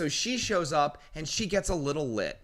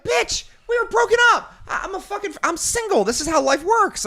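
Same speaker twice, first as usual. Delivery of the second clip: high, loud exasperated shout; shouting back; 7 dB louder than usual. Retold or imitated. Arguing with the angry girlfriend, loud exasperated shout. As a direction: yell it at her, fed up. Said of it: "I'm like".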